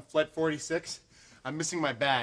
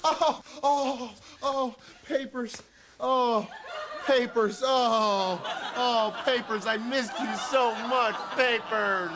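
overjoyed; 5 semitones higher, slower than usual. Acted. Overjoyed at finding papers.

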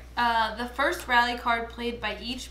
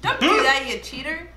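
playful shout; 4 semitones higher, 10 dB louder than usual. Real, not acted. Playful accusation in game.

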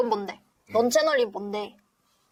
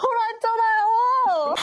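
mock whine; 10 semitones higher, 4 dB louder than usual. Whiny protest.

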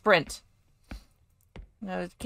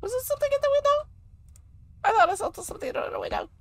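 very high, quick squeak; high-pitched, hurried worry; squeaky worry; much faster than usual. Acted. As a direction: rattle it off in a falsetto. Jumpy at something in window.

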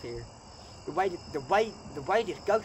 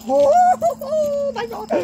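sudden high, loud shriek of real fright; frightened scream; 13 dB louder, slower than usual. Real, not acted. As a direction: yelp in genuine terror as it lights up. Ghost detector suddenly lights up.

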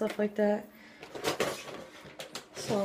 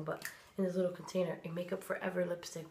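normal talking voice

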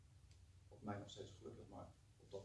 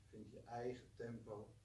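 guided meditation. Acted. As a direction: gently guide them to relax. Leads a short meditation.

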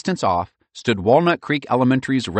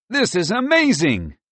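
excited exclaim; 12 semitones higher, 4 dB louder than usual. A narrator's reading. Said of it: "Merle exclaimed".

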